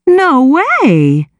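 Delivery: disbelief. A narrator's reading. Sister shocked at the news.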